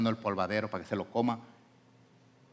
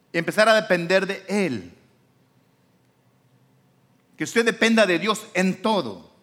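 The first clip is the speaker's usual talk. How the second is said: higher, louder preaching to the congregation; full-force preaching; 8 semitones higher, 4 dB louder than usual. Acted. Preacher urging the congregation to depend on God, fervent preaching. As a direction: preach it out with fervor.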